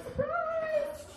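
very high sing-song "Surprise!"; mock surprise. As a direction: announce it brightly way up high. Mother's announcement, high.

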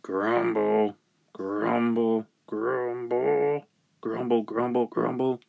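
rumbling repeated grumble; grumbling. Acted. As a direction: grumble the word like muttered complaint. Voices the farmer's grumbling.

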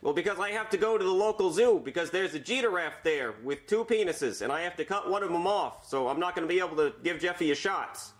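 doctor voice. Pretend. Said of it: "make Jeffy sound like the doctor".

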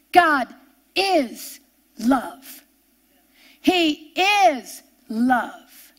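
"is" drawn out slow and weighty; slow, weighty "He is love"; slow emphasis. Real, not acted. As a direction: lean hard on "is" in "God is love". Preacher slows on key truth.

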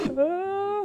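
high, slow and giggly; laughing. Real, not acted. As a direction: laugh through the words. Laughing at the filter.

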